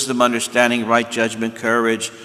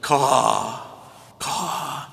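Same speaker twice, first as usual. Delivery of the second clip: mock caveman croak of relish, then fading; hammed-up Stone Age man sighing caw after a drink; caveman satisfied caw; 2 semitones higher than usual. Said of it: "and then he goes".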